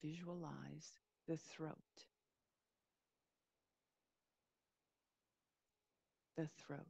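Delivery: calm, unhurried meditation voice with long pauses; guided meditation. Real, not acted. Yoga teacher easing listeners into a body scan, guided meditation. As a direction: settle listeners gently into a body scan, leaving space between phrases.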